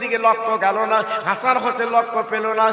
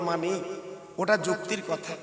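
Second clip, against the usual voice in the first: lower, much quieter voice; softened reply; 4 semitones lower, 10 dB quieter than usual. Acted. Mother conceding a point to her sons, softly and low. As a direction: drop right down to a soft concession.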